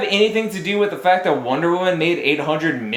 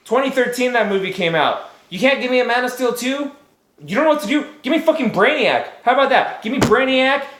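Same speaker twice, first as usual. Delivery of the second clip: angry rant; 9 semitones higher than usual. Real, not acted. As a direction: rant at the studio, pitch climbing. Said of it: "Sorry, I'm mad".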